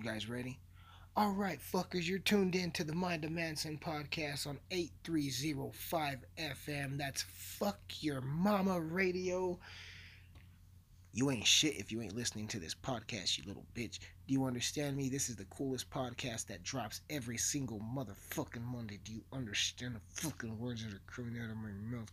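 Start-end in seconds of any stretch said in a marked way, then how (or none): 1.2-9.6 mock radio host, pitched up, drawing out the station name; cocky radio DJ. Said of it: "I'm gonna be a cocky prick just like that, that radio D J was". 11.1-22.1 mock-aggressive radio host, not shouted; lightly put-on tough-guy radio patter; aggressive cocky DJ. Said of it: "It makes me sound like I'm really aggressive".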